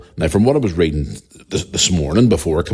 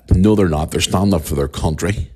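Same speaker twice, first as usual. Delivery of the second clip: ordinary talk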